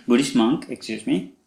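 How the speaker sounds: usual speaking voice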